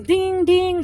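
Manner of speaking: high sing-out doorbell chime; imitated doorbell. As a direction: mimic a doorbell's high ring.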